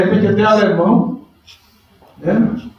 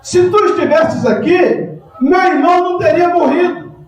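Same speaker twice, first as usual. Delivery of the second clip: Martha's reproach; 10 semitones higher than usual. Acted. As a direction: throw it at Jesus as a reproach. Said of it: "olha só a atitude de Marta".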